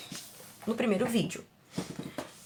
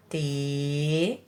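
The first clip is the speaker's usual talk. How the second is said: "Telhado" very slowly, lower and softer; drawn-out syllables; 5 semitones lower, 6 dB quieter, much slower than usual. Shown as a demonstration. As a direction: stretch te and lha with long pauses, then do. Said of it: "três sílabas". ("Telhado" bem devagar, sílaba por sílaba)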